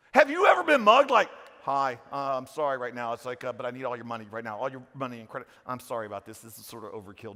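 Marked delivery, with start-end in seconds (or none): 1.6-7.3 soft, apologetic mugger murmuring his demands; overly polite mugger. Retold or imitated. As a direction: play a mugger who keeps saying sorry, quietly. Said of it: "You don't get mugged like that unless you're in Canada".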